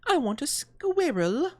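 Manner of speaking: high, slow whiny demand; spoiled bratty girl. Retold or imitated. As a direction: whine for the squirrel. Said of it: "What's that one girl from".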